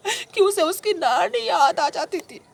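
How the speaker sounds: very high, slow drawn-out line; dramatic climax. Acted. Telling sahib of the brutal beatings, dramatic climax. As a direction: stretch it high for dramatic horror. Acted climax of friend's violence.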